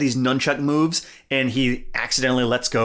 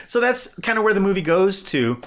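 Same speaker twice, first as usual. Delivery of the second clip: ordinary talk, nothing marked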